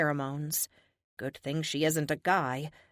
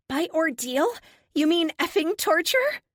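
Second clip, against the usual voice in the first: high and bitter, a little louder; high, sharp, incredulous prisoner; surprisingly high voice; 12 semitones higher, 6 dB louder than usual. Acted. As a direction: throw the word back, pitched up high. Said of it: "Her voice is surprisingly high".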